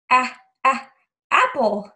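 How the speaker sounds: "a, a" short clipped a sounds before "apple"; sounding out. Shown as a demonstration. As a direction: stretch the first sound, then say "apple". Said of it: "Can we sound it out together?".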